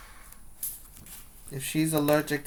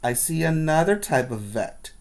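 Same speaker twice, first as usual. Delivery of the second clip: put-on lively read-aloud for children; storybook reading voice; story narration; 5 dB louder, slower than usual. A narrator's reading. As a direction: tell the story brightly to the class. Teacher reads picture book aloud.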